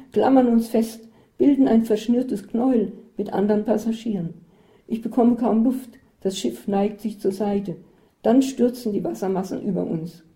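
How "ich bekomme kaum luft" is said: plain reading voice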